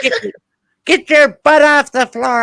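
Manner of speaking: put-on high, loud, bossy old woman's voice; scolding old lady. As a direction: scold him like a cranky babysitter. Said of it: "Old Aunt Gladdy comes over".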